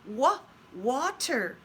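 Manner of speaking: "Wa-, water" leaning hard on the W sound; slow W-sound demo for kids; stretching the W. Shown as a demonstration. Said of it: "you see W's".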